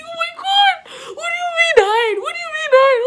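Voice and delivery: high, loud, near-tearful shouts of disbelief; panicked crying shout. Retold or imitated. Friend says hide, she shrieks back in crying panic.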